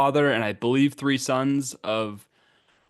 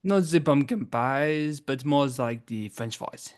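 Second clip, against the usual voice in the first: "Not the pumpkin pies" slow, with zee for the; thicker, slower French accent; slower than usual. Pretend. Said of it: "we doing French accents".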